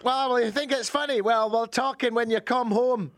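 high, hammed-up accented telling-off; scolding partner. Partner scolding Taylor over the fish, high indignant accent.

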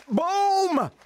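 high, loud mic-drop Boom!; triumphant boom. Acted. Host capping his billboard joke, triumphant shout. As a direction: slam the point home with Boom!. Punchline exclamation.

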